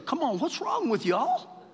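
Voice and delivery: high-pitched West Texas plea; West Texas drawl. Acted. Jesus chiding his disciples, high West Texas drawl. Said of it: "in his West Texas".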